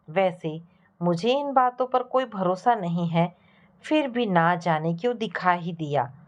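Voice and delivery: plain reading voice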